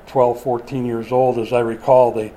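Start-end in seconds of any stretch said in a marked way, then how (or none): none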